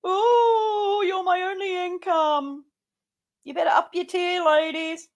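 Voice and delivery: high, whining mock of the host; mocking whiny voice. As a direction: imitate him begging for money. Mocks host's money plea.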